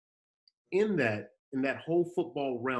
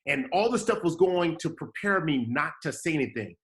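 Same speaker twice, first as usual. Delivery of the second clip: normal talking voice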